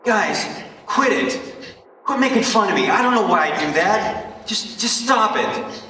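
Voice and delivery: put-on high, loud, upset boy yelling at the kids teasing him; angry kid yelling. Boy with tics yells at classmates teasing him, high loud anger. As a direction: snap back hard at the kids mocking you. Acted boy lashing out at teasers.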